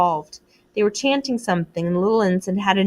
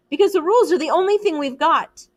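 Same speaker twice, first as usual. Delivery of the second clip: raised high insistence; insistent; 9 semitones higher, 4 dB louder than usual. A narrator's reading. Ralph defending the rules, raised and insistent. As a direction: plead the rules hard.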